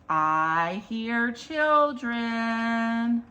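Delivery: rhythmic picture-book read with a building list; reading to children. Acted. Storyteller reads the book's ending to children, rhythmic. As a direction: draw out the question, then roll through the list.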